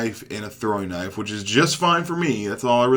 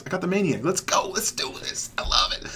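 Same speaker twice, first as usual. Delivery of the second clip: higher pitch dropping into a hushed thrill; excited, fading into near-whispered glee; pumped up; 4 semitones higher, 7 dB quieter than usual. Real, not acted. Gamer who just got the Maniac, quietly pumped. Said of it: "Sorry that I'm all pumped up".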